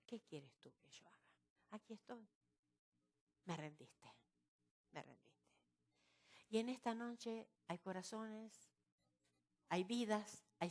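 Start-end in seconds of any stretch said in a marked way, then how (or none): 0.1-5.3 very soft, low, prayerful submission; humble surrender. Acted. Preacher voicing Saulo kneeling before the Lord, humbly surrendering. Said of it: "dobló su rodilla y dijo".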